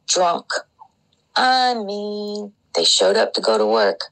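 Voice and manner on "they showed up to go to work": normal talking voice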